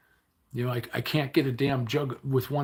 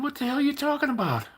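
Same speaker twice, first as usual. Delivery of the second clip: shocked disbelief; 5 dB louder than usual. Retold or imitated. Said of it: "I said".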